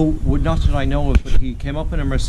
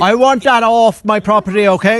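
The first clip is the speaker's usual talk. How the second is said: loud sharp voice; 8 semitones higher, 3 dB louder than usual. Real, not acted. Angry neighbour confronting trespasser.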